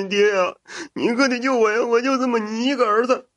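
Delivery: high whimpering sob, begging; tearful pleading. A narrator's reading. Son begging his father to save him, tearful pleading. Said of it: "哭着哀求道".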